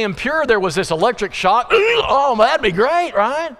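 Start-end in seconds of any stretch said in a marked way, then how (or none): 1.7-3.5 high, sing-song mock cheer; high mock cheer, tongue in cheek; mock delight. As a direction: play it like a naive wish come true.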